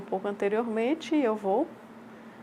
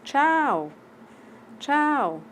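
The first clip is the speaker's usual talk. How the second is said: bright, high, smiling "tchau, tchau"; a beaming, lively farewell; cheerful goodbye; 7 semitones higher, 4 dB louder than usual. Shown as a demonstration. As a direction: wave goodbye with a big smile in the voice. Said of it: "A pessoa está sorrindo".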